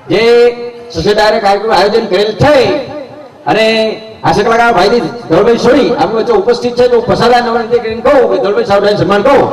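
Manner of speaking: high-pitched, overblown stage introduction; ear-splitting village announcer. Retold or imitated. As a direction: announce it like an overexcited village host. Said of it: "ફાડી નાખે એવી".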